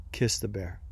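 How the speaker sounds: a hint of a divine voice, low and quiet; voice of God. God answers the fleeing dreamer, understated low command. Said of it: "literally like the voice of God".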